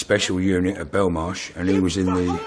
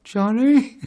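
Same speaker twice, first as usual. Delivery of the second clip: high, squeaky pleading call; mock timid grovel, pitched way up; grovelling; 4 dB louder than usual. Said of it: "and, uh, grovelled like".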